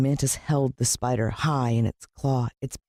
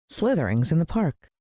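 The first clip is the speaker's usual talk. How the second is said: ordinary delivery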